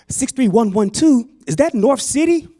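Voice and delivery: high, questioning phone-agent voice. Acted. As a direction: query it back as the agent, pitch climbing. Agent doubts caller's zip code.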